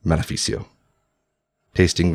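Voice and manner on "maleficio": name uttered with restrained disgust; spat-out name. A narrator's reading. Cesare naming the man behind the assassin, spat out. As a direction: spit the name out under control. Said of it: "spat out a name".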